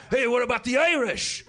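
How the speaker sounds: imitating a belligerent Irish guy; Colin Quinn impression. The Irish vet butting in, mock Colin Quinn voice. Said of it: "he was like Colin Quinn Irish".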